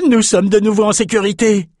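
high-pitched jubilant sailor; excited cry. A narrator's reading. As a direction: cry out in relief. Sailor cries out in relief. (cri aigu de soulagement)

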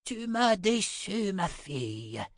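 put-on slow, high old crone voice; drawn-out maternal reproach; old woman. A narrator's reading. Old mother to her daughter, reproachful old woman. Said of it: "dit la vieille femme".

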